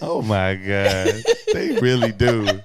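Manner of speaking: loud, slow words through real laughter; cracking up while trying to talk; laughing hard. Real, not acted. Co-host tickled by the accent hint, laughing hard.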